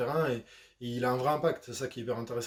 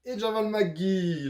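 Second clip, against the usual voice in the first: higher, louder, drawn-out name reveal; hyped name announcement; 7 semitones higher, 5 dB louder, much slower than usual. Real, not acted. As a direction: announce him slowly like a star entrance. Hyped roll-call of player name. (annonce de joueur enflammée, en traînant)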